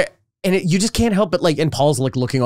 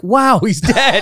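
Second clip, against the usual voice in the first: slow, higher mock deadpan; mock deadpan reaction; 7 semitones higher, 4 dB louder, much slower than usual. Joking Beatle reacting slowly to John's death, mock deadpan. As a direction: drag out the fake shock. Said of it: "he's like".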